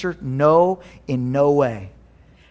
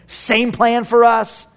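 fervent preaching; 8 semitones higher, 4 dB louder than usual. Acted. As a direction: build to a climax. Preaching climax.

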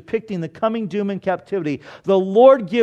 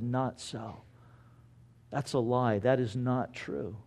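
low, soft contradiction of a claim; quiet denial; 4 semitones lower, 10 dB quieter than usual. Acted. Preacher voicing Jeremiah answering the false prophets, quiet denial. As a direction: deny it softly, dropping your voice.

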